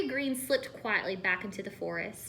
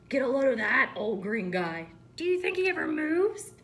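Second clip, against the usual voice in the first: mocking; 3 semitones higher, 6 dB louder than usual. Young snake mocks old snake.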